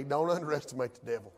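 ordinary talk, nothing marked

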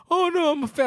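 high-pitched mock innocence; innocent protest. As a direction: imitate a harmless fan, voice way up high. Imitates suspected newcomer's innocent excuse.

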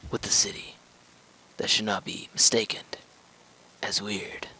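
secretive unvoiced whisper. Gamer hinting to viewers, mock conspiratorial whisper.

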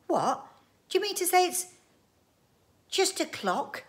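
high, loud disbelief; incredulous question. Acted. Wife questions the time machine, high and incredulous.

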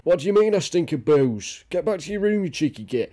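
mock telling-off from a boozy father; a hint of an angry drunk dad; drunk scolding dad.

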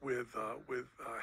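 ordinary talk, nothing marked

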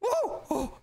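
very high panicked oh oh; startled yelp. Real, not acted. Nearly tagged by brother, very high startled yelp. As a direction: yelp as you almost get caught.

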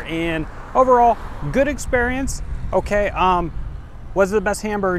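vlog outro. Acted. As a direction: sell it to the viewers. Upbeat outro review wrap-up.

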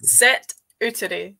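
"sit uteri" split up slowly after sit; broken into parts. Shown as a demonstration. Host breaks the Scots word down for the guest, split slowly. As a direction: pull "sit uteri" apart so sit stands alone. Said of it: "if you take it like that".

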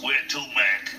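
imitating a cabbie talking out of the side of his mouth; gruff cab driver. Cab driver asking his passenger where to, out of the side of his mouth. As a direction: talk out the corner of your mouth like a New York cabbie. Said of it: "a voice came out the other like this".

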